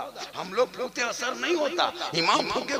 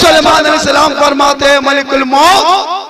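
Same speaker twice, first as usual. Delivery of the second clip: full-force preaching; 4 semitones higher, 14 dB louder than usual. Acted. Preacher telling the mother's-prayer miracle to the congregation, full-force preaching. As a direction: belt the holy story out to the crowd.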